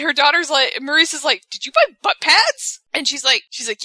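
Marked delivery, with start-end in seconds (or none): 1.5-2.8 very high-pitched mock disbelief; retold astonishment, pitched way up; imitated incredulous question. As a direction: squeal the question as the shocked daughter. Retold daughter questions mother's shopping.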